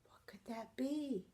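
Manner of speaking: soft whisper with a gasp; storyteller whispering in suspense; suspenseful whisper. Acted. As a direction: hush the children for the mystery.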